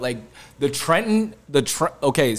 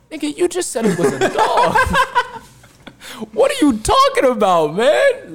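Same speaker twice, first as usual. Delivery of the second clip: very high, laughing incredulous retort; high laughing what are you talking about; laughing disbelief; 6 dB louder than usual. Retold or imitated. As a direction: crack up in disbelief.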